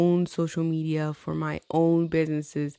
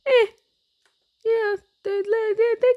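mocking high voice. Mocking bosses' attitude.

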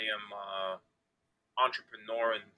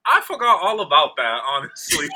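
suddenly loud, amazed; loud excited disbelief; 8 semitones higher, 14 dB louder than usual. Real, not acted. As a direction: blurt it out in happy disbelief. Guest surprised by old memory.

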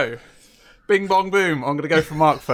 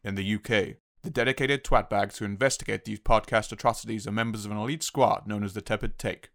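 low, solemn TV crime announcer; announcer voice-over; 4 semitones lower, 6 dB quieter than usual. Acted. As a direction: intone it like a cop-show opener.

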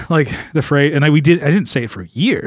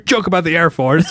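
ordinary delivery

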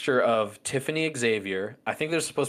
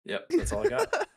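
laughing. Real, not acted. Laughing at own lack of notes.

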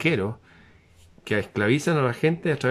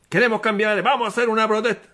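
higher, louder and fast, a mock eager protester; hammed-up chant of would-be revolutionaries; 10 semitones higher, 4 dB louder, faster than usual. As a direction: parody the naive activists.

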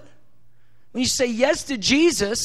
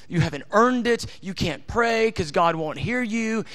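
ordinary talk, nothing marked

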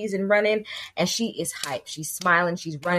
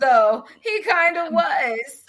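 laughing while talking; 8 semitones higher, 4 dB louder, slower than usual. Real, not acted. Laughing agreement, voice breaking.

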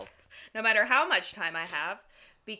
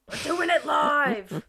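loud, high, drawn-out declaration; mock outburst; 6 semitones higher, 8 dB louder than usual. Host retelling giving up on tech troubles, loud mock outburst. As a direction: belt out the defiant decision. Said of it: "And I was like".

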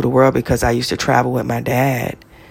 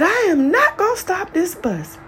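dad's frantic cry. Retold or imitated. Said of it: "my dad scream out loud".

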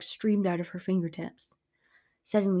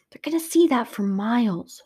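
loud, higher sharp snap; snapped; 4 semitones higher, 5 dB louder than usual. Acted. Serga snapping at the crew about the explosion. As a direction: warn them harshly. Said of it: "Serga snapped".

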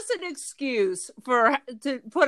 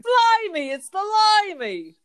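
higher, louder mock cockney exclamation; hammed-up cockney shout; 9 semitones higher, 4 dB louder than usual. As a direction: blurt it like a stage Cockney. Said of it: "Michael Caine voice".

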